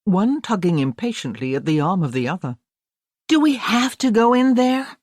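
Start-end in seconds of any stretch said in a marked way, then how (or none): 3.3-5.0 put-on high, reluctant American girl's whine; a bored young American dragging her feet; flat American accent. A narrator's reading. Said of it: "in a flat American accent".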